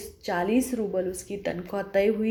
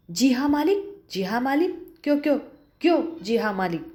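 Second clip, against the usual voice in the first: angry stammer; 4 semitones higher, faster than usual. Acted. The employer mocks Julia's reply, stammering with rage. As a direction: sputter the words in a rage. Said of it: "गुस्से से तुतलाने लगता है".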